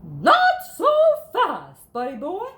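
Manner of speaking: loud, high stop; stern principal. A narrator's reading. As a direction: catch the dog out sternly. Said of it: "the voice came from behind him".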